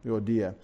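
Euodia softly as oh-DEE-ah; elided pronunciation. Shown as a demonstration. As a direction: run the opening vowels of Euodia together. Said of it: "elide all those vowels in the beginning of her name".

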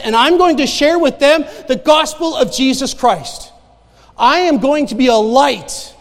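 loud, high, impassioned declaration; rousing, emphatic testimony of faith; full-force preaching. Acted. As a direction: proclaim your resolve to the congregation.

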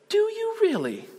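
very high, wide-eyed woman's question; imitated amazement. Woman hearing of worldwide outlets, high amazed imitation.

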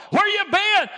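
high, loud demanding shout; Rahab shouting. Acted. Preacher voicing Rahab, loud impatient shout. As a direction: yell it like she has waited all night. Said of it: "what did she say?".

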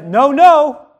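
loud, high emphatic no-no; parent scolding child. Acted. Parent telling a child no, emphatic scolding. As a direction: scold a child with two punched noes. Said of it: "When we tell a child no".

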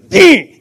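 short, high zap noise; imitated shock-collar buzz. Preacher telling how the bark collar fires, mimicked zap. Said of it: "so the thing goes".

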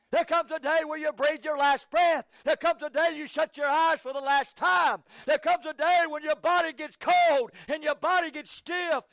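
loud, high, urgent pulpit warning; shouted revival preaching in rolling repetitions; full-force preaching. Real, not acted. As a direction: hammer out each phrase as a warning.

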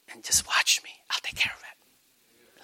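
slow, intimate whisper; God whispering close. Acted. God privately assuring a believer he will provide, whispered. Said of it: "if God nuzzles over to you and says".

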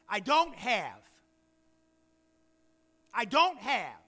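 loud, sulky "I don't have"; a loud sulky complaint of lack; loud complaining believer. Acted. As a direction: act the grumbling have-not, loudly. Voicing a complaining poor believer.

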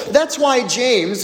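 normal talking voice

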